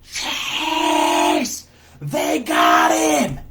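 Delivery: high scream at the top of the lungs; terrified scream. Retold or imitated. Chelsea screaming for help as a boy is taken, terrified scream. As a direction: scream a long, drawn-out alarm, imitating her terror. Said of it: "at the top of her lungs".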